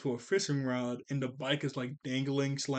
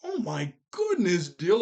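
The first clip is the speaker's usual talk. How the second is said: phony fake enthusiasm; 3 semitones higher, 3 dB louder, much slower than usual. Brother faking excitement to humour Dill, phony amazed voice. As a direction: ham up fake wonder, like you don't mean it. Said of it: "that's how he's talking".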